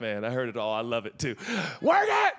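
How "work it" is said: high, loud pulpit shout in jest; preacher parody. Acted. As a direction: yell the slogan like a preacher. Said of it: "I've grown up in church".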